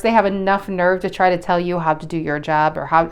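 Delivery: usual speaking voice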